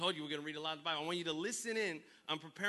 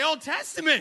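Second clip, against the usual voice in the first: shouted call; 11 semitones higher, 16 dB louder than usual. Real, not acted. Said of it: "Somebody say".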